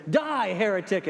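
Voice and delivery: high, loud joke punchline; hammed-up cry of condemnation; mock outrage. Joke teller voicing the man on the bridge, mock outrage. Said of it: "And the guy says".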